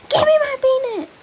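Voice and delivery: high, fast, loud shriek; shrieking demand. Acted. Child demanding her bee net back, high shriek.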